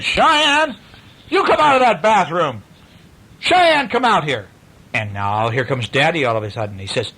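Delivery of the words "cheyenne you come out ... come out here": put-on high, loud shouting boy; pounding on the door. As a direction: yell at your sister through the bathroom door. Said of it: "Hunter is pounding on the door".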